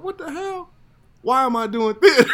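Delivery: what the hell, why bother with this; mock outrage. Actor retelling his paycheck shock to hosts, squeaky disbelief. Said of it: "and I'm like".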